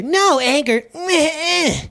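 high, whiny fending-off noises; hammed-up resistance; mock refusal. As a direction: push it away with a childish protest. Acts out pushing anger away.

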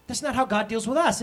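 normal talking voice

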